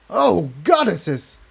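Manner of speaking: high, slow, choked-up disgust; gagging in disgust. A narrator's reading. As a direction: recoil from the bitter taste. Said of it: "he gagged".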